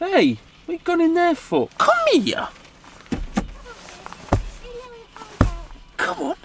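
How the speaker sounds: calling out. Real, not acted. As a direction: call the dog in, high and bright. Calling a dog over.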